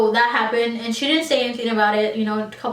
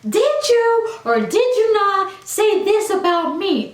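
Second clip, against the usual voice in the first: much higher and louder, slow, each word pushed at her; confronting yell; 10 semitones higher, 3 dB louder, slower than usual. Retold or imitated. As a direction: yell it right in her face. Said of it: "Just like that, like this in my face".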